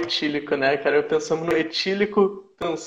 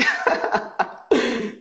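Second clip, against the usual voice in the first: higher-pitched real laugh; 6 semitones higher than usual. Real, not acted. Guest joking that it must wait past midnight, laughing. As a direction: crack up at your own joke.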